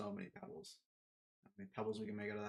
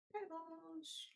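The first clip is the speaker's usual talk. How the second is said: high calling voice. Pretend. Calling a pet.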